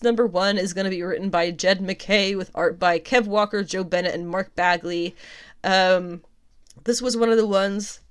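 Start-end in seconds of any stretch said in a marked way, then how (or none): 5.6-8.0 strained voice while choking; choking on a drink. Real, not acted. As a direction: talk past a catch in throat. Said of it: "I'm choking".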